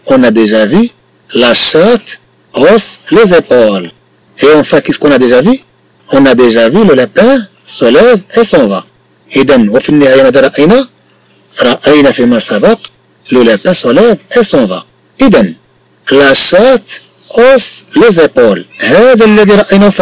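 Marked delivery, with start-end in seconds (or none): 1.3-3.9 very slow dictation. Shown as a demonstration. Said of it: "teacher reads sentence slowly". 16.1-18.6 very slow dictation. Shown as a demonstration. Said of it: "teacher reads sentence slowly".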